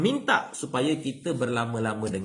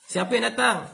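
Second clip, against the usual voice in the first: high, loud call from inside the house; householder calling out; 10 semitones higher, 4 dB louder than usual. Retold or imitated. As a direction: call out to ask who is at the door. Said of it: "ditanya oleh tuan rumah". (tuan rumah bertanya dengan suara tinggi dan kuat)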